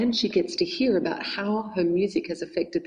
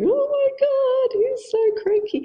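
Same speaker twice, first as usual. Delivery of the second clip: much higher, slow, squeaky mock alarm; squeaky inner alarm; 5 dB louder, slower than usual. Noticing her grumpy partner, squeaky high mock panic. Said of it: "feeling the vibes going".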